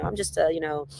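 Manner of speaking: usual speaking voice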